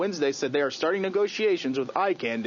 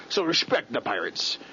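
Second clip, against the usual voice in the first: ordinary talk, nothing marked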